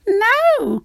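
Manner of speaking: high, loud frightened no; the witch refusing the dragon in fear; witch crying no. Acted. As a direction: cry out in alarm. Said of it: "cried the witch".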